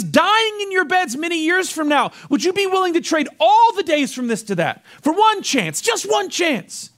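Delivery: high, impassioned address to troops; rally an army before battle; inspiring battle speech. Acted. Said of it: "his troops this speech that inspires".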